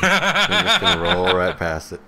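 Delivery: high, laughing brush-off; amused refusal. Real, not acted. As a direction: laugh while waving it away. Laughing dismissal of pun.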